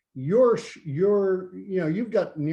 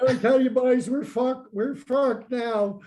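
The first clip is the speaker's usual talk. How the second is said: high, loud, dragged-out Bubbles voice; rough stab at a Trailer Park Boys character; Bubbles impression; 8 semitones higher, 4 dB louder than usual. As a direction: pitch it way up and stretch the last words like Bubbles. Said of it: "I can't do Bubbles".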